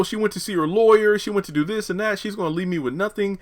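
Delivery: a client spiralling about his wife's lawyer; freaking out. Retold or imitated. Victor to Andy about his wife, mock panicked freak-out. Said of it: "he's freaking out".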